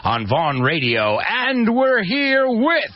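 high, stretched-out show intro; radio show announcer. Acted.

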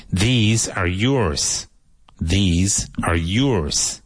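very slow, each word drawn out; slow model. Shown as a demonstration. Teacher saying the sentence slowly for learners to repeat. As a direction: stretch "These are yours" out slowly. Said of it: "modelling slowly".

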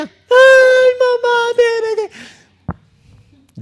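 mock crying. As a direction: whine like a crybaby child. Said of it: "Se fue llorando cual niña". (imitación burlona de un niño llorando, muy aguda)

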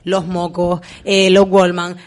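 ordinary delivery

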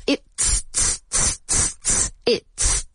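like playing drums, "it's, it's"; drumlike it's. Shown as a demonstration. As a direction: tap out "it's" like hitting a snare. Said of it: "como si estuvieras tocando la batería".

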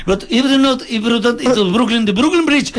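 lightly voiced foreign taxi driver, English with a Mexican accent; New York cabbie impression. Host imitates a New York taxi driver to the panel, mock accented English. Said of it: "Los taxistas en Nueva York".

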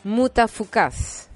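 slow, drawn-out title announcement; announcer voice. Acted.

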